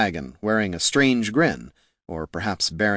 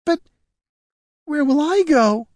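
forlorn, much higher woman's voice; lip quivering; 3 dB louder than usual. Acted. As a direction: ask it helplessly, about to cry. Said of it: "lip quivered uncontrollably".

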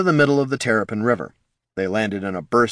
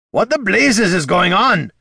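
loud, higher dwarf roar; 11 semitones higher, 6 dB louder than usual. Acted. Gorm demanding answers mid-fall, roaring. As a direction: bellow it in alarm. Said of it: "Gorm roared".

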